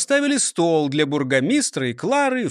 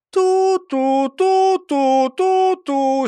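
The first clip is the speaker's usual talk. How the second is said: high, loud, slow siren toots; fire engine horn; 14 semitones higher, 5 dB louder, much slower than usual. Acted. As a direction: toot like a fire truck. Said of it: "сигналил Нисы Чих". (гудок пожарной машины)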